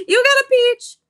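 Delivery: very high, loud outburst; delighted squeal. Real, not acted. Streamer reacting to a follower's gift, delighted squeal.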